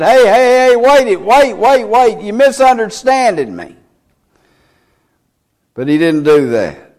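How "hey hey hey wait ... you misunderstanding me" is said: high, hurried pretend backpedaling; mock urgent protest. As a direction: play someone frantically calling for a timeout, pitched well up. Imagined Jesus protesting to stone-throwers.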